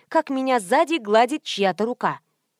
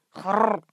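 short snorting grunt; donkey snort. A narrator's reading. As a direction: snuffle like a donkey.

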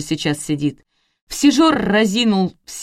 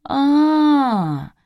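high, long 'aah' gliding down; drawled, higher-pitched interjection; drawn out; 9 semitones higher than usual. A narrator's reading. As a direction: stretch the vowel high, then let it sink. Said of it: "протянул Димон".

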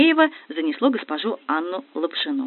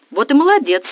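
plain reading voice

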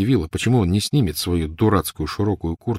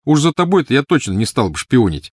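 put-on high, louder scornful boy; 7 semitones higher, 5 dB louder than usual. A narrator's reading. Snape dismissing Petunia, scornful. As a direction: put her down with contempt. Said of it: "добавил он презрительно".

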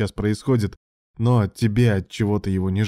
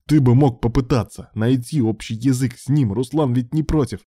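raised, heated reproach; angry; 4 semitones higher, 5 dB louder than usual. A narrator's reading. Ilya reproaches Alan over Ruslan, heated. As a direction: argue hotly, voice up. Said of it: "проорал Илья в гневе".

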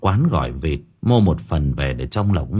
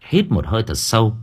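usual speaking voice

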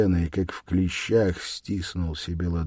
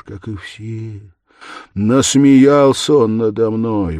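loud, higher, impassioned self-accusation; agitated murderer; 8 semitones higher, 10 dB louder than usual. A narrator's reading. Murderer proving his point to Sonya, agitated. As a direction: argue feverishly, voice surging on key words.

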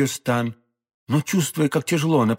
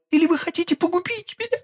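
much higher, alarmed woman's voice hushing him; frightened hush. A narrator's reading. Madame Bonacieux hushing d'Artagnan, high and frightened. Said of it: "воскликнула молодая женщина".

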